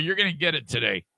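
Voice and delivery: ordinary talk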